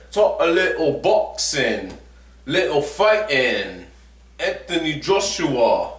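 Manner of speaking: a vlogger's shaky go at British English; attempted British accent. Shown as a demonstration. Vlogger testing his British accent on viewers, attempted British accent. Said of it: "let me try to do my British accent".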